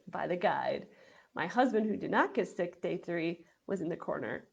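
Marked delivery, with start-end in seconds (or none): none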